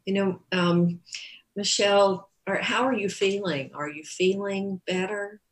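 ordinary talk, nothing marked

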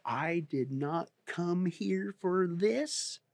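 mimicking the offended lady. Offended churchgoer scolding the pastor, slow quiet indignation. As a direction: drag out her complaint with prim indignation. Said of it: "She says".

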